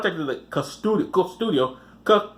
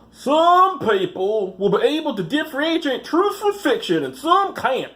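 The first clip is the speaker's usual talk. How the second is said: high, slow Missouri drawl impression; hammed-up Missouri accent voicing the camp director; 9 semitones higher, slower than usual. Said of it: "I should do a voice for him".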